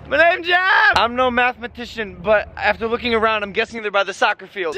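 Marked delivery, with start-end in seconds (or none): 0.1-0.9 high loud mock Jeff line; imitating Jeff toy. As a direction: yell the catchphrase up high. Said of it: "My name is Jeff".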